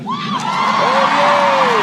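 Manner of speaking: high, loud sarcastic yay; mock cheer. Commentator jeering at the applauded abortion line, mock cheering. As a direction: fake a squealing celebration over the applause.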